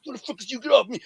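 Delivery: high mock-struggling voice; imitated victim protesting. Man slammed against the wall by his throat, high imitated protest.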